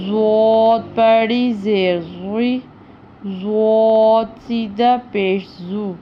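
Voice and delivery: ضو ضوی زو said thick and full; thick heavy ض sound; heavy dhad. Shown as a demonstration. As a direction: read ض heavy. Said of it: "یہ بھی پر پڑھا جائے گا، موٹا پڑھا جائے گا".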